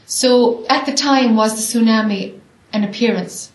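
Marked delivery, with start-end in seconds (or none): none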